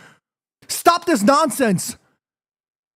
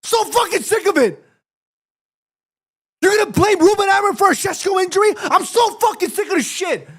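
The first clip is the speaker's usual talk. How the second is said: fed-up, high-pitched rant; heated; 6 semitones higher than usual. Real, not acted. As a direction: rant like you're sick of it all. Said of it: "I got a little heated".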